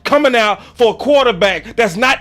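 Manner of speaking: loud, high fed-up rant; venting. Real, not acted. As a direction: let off steam at rival fans. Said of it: "can I vent just for one second".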